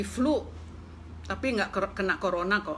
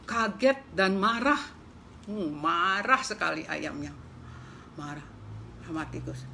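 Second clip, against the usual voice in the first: ordinary delivery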